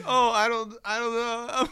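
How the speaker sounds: high, faltering mock uncertainty; imitated flustered barista. New barista put on the spot, mock flustered. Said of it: "She's like".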